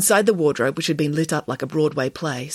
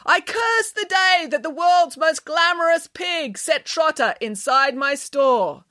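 high, slow melodramatic curse; bad actor; 11 semitones higher, slower than usual. A narrator's reading. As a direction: declaim it with hammed-up anguish. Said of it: "was not a very good actor".

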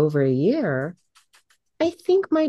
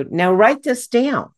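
higher, louder, slow instruction; slightly raised voice; 7 semitones higher, 5 dB louder, slower than usual. Shown as a demonstration. Speaker making the room take note, voice raised a little. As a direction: raise your voice a little and stretch it out. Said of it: "I'll raise my voice just a little bit".

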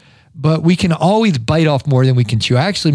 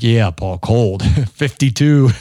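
mocking Ohio friends; 5 semitones lower, slower than usual. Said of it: "My friends up in Ohio are like".

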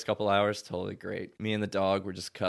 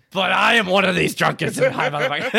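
loud, high mock protest through laughter; laughing protest; 12 semitones higher, 7 dB louder than usual. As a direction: burst out protesting, cracking up.

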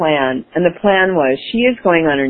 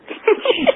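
high giggle. Real, not acted. Laughs at own plan.

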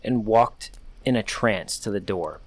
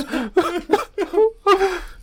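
very high, eerie wailing cry; a creature faking a grown man's crying; mimicked howling sobs; 4 dB louder than usual. A narrator's reading. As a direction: howl that breaks into fake sobbing. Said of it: "horrible howling that morphed into sobs".

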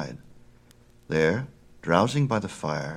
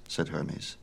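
usual speaking voice

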